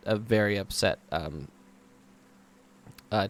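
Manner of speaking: normal talking voice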